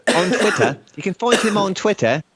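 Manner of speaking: hammed-up British accent for the social plugs; put-on British accent. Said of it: "following through on this accent".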